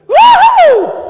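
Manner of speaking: big high whooping yell. Retold or imitated. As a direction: let out a wild joyful whoop. President whooping down hill.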